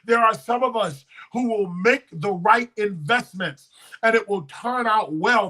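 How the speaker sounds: normal talking voice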